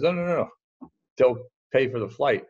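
playful scout impression; imitating the scout. Scout reassures broke young player.

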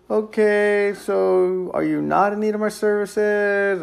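high-pitched, drawn-out uncertain question; awkward, tentative asking. Retold or imitated. Salesman to silent lady closing the door, awkwardly tentative. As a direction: stretch it out high, as if unsure she'll answer.

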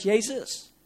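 mild mock cry; imitated shout. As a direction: call out, but not at full volume. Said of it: "Bart schreeuwt".

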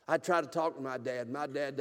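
ordinary delivery

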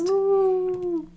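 high breathy ghostly woo. Acted. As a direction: moan it like a little ghost. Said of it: "You sound like a ghost".

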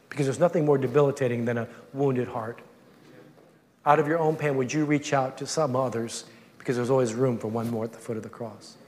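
soft, low, gentle questioning; tender voice of the Lord. Retold or imitated. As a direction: speak it softly, like a caring father. The Lord speaking gently to him.

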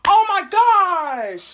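high, loud, drawn-out shock; shocked shriek. Real, not acted. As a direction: shriek it in disbelief. Shocked reaction.

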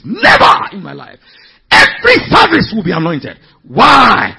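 full-force preaching. Real, not acted. Preacher building to a shout at the congregation. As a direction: preach it hard, climbing to the peak.

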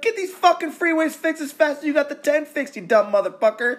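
angry rant. Real, not acted. Podcast host ranting at the governor, furious. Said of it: "That's passion right here".

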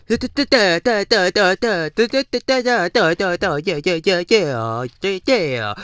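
playful imitation of nervous stuttering; mock stutter. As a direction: stammer like a starstruck fan who can't get words out. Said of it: "They stuttering and stuff".

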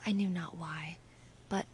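usual speaking voice

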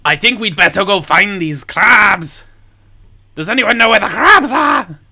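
loud high calling out; shouting. Acted. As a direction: shout it down to the crew. GM voices Helga shouting.

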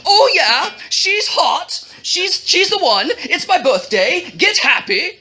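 high-pitched giddy king; excited exclaiming. A narrator's reading. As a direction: burst out as a king dancing with delight. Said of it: "and exclaimed".

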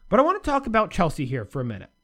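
ordinary delivery

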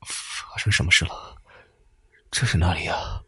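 faint breathy whisper of an injured man; weak, struggling. A narrator's reading. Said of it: "艰难地说".

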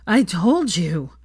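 slow, loud, out of breath; panting between words; gasping. Acted. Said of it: "he gasped".

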